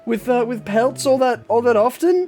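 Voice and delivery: high, halting dwarvish shopkeeper; dwarvish woman. Acted. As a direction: apologise in a high accented dwarf voice. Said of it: "she looks at it and says".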